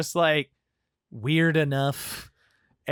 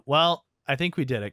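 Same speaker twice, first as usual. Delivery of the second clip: ordinary talk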